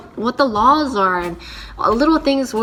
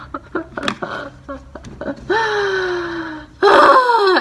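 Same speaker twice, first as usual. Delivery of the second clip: teary laugh that breaks into a sob; crying laugh; 11 semitones higher than usual. Real, not acted. Laughing at herself on camera as tears come, crying laugh. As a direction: laugh through sudden tears. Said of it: "Why am I so emotional?".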